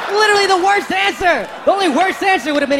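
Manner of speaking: high, loud incredulous rant. Acted. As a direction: shout it in disbelief. Comedian ranting at punchline.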